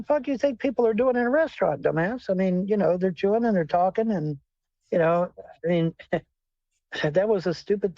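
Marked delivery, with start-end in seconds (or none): none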